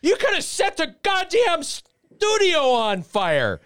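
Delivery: imitating the boss exploding; mock outraged boss. General manager scolding Carl over the fire stunt, high mock outrage. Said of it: "he's like".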